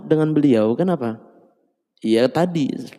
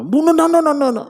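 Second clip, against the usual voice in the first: higher pitched quick protest; fussing wife; 14 semitones higher than usual. Acted. Wife brushes off husband's apology, higher voiced. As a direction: wave him off with repeated no. Mock quarrel voice.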